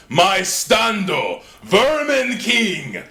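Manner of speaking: high, loud, dragged-out shout; hype call-out. Pretend. Hyping the Vermin King as a JoJo stand, loud, high and drawn out. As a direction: bellow it like an anime stand summon. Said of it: "JoJo stand call-out".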